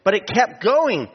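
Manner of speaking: high, quick, delighted player's voice; excited retelling. Retold or imitated. Player to cheerleader, high and thrilled. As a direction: tell it like the thrilled shooter. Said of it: "He said".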